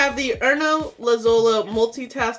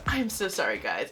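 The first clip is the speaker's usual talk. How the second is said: usual speaking voice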